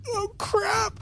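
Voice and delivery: a tiny creature realising it's doomed; bird panic. Retold or imitated. Bird fighter seeing the barbarian rage, squeaky panicked yelp. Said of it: "Mike's like".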